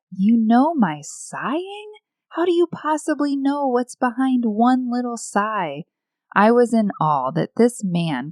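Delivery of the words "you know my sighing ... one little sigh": incredulous, higher-pitched inner question. Retold or imitated. Woman asking her husband how he knows her sighing, incredulous. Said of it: "and I thought".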